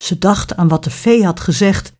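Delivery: plain reading voice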